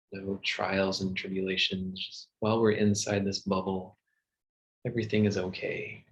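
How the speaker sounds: ordinary talk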